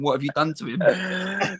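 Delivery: slow, mildly tearful imitation of mum; a touch of mock tearful dismay; mum crying. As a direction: drag it out like a shocked, weepy mother. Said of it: "my mum cried in the airport, going".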